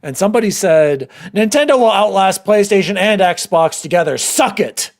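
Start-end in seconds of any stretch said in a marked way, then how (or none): 1.3-4.9 loud, higher fan taunt; boastful comment read-out. Retold or imitated. Reads out viewer's boastful comment.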